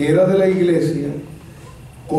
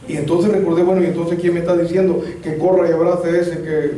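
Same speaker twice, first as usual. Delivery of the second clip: usual speaking voice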